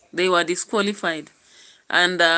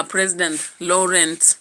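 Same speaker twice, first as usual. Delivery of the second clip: ordinary delivery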